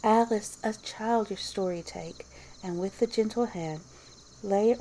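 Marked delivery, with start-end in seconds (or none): none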